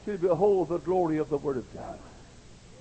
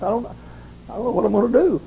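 raised, halting plea; retold weary plea; 9 semitones higher, 5 dB louder than usual. Tired preacher retells praying to God at night, pleading haltingly. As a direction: plead it, stumbling at the start. Retold prayer, first take.

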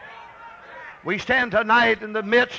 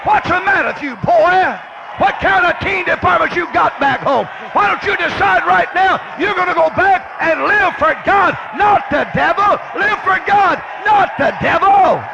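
high, shouted pulpit exhortation; full-force preaching; 8 semitones higher, 4 dB louder than usual. Real, not acted. Preacher challenging the teenagers to live for God, shouting. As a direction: shout it at the congregation, driving each phrase home.

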